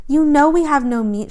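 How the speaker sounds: loud, high insolent retort; cheeky, loud defiance. A narrator's reading. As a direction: sneer it back at him. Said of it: "she said insolently".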